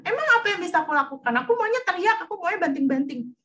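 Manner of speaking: put-on high, loud, fast defiant kid; a kid insisting it wants to scream; stubborn child voice. Child voiced in example dialogue. (suara anak kecil yang ngotot, tinggi dan keras)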